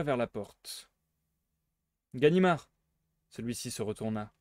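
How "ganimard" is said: louder call after him, higher; sudden call to the departing policeman. Acted. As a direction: hail him back at the door. Calls the departing policeman back.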